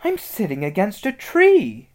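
high, loud exclamation of amazement; startled discovery. Acted. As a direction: blurt out an astonishing find.

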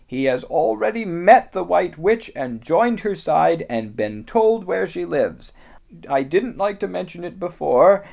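high, loud, grave beaver voice; a small creature delivering bad news; Mister Beaver.

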